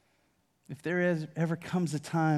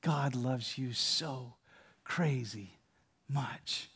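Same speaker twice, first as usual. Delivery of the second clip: breathy whisper, lower and slow; 3 semitones lower, 6 dB quieter, much slower than usual. Pretend. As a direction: hug them close and breathe it in their ear. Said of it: "and whisper in your ear".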